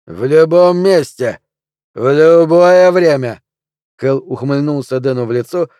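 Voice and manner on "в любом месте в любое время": slow, loud, higher dare; loud barroom bravado; belligerent challenge. A narrator's reading. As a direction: dare him, stretching every word. Drunk man takes up the challenge.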